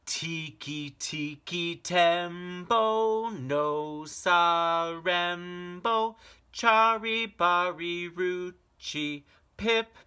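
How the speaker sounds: high, loud, slow desperate call; last-breath shout. Acted. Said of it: "he shouted".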